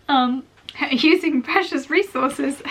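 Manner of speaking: laughing through the words, slowed. Real, not acted. Vlogger joking to viewers about her drops, laughing. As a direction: giggle at your own joke.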